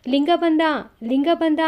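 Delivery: excited shout. A narrator's reading. Villagers shout that Linga is back, excited shout. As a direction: shout the news from outside. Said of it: "ಅಂತ ಕೂಗು ಕೇಳಿಸುತ್ತೆ".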